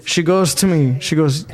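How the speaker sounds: ordinary talk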